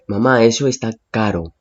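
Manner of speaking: usual speaking voice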